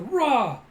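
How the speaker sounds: high, loud short roar; imitated battle yell. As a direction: roar like a straining fighter. Imitates fighter's cry.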